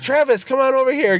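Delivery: high-pitched sing-out to a coworker; calling someone over. Retold or imitated. Boss beckons shy coworker over for a hug, high calling voice.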